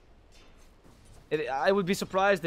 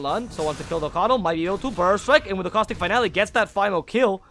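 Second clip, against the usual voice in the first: louder, rising esports commentary; excited play-by-play; 3 semitones higher, 7 dB louder than usual. Real, not acted. Caster calling a kill as it lands, excited play-by-play. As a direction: build the call up to the kill.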